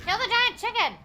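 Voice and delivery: yelled high and loud; gamer battle cry. Real, not acted. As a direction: charge in yelling at the boss. Yelling at game boss.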